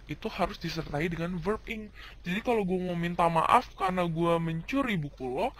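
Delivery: normal talking voice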